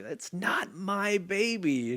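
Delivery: high, slow, drawn-out refusal; mock dismissive retort. Host retells character disowning the baby, slow pointed retort. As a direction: stretch out each word in pointed denial.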